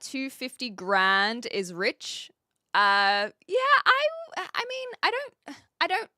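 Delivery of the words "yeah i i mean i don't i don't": jumps much higher, caught off guard; a startled high squeak of protest; flustered disbelief at the question; surprised. Real, not acted. Reacting to viewer's rich question.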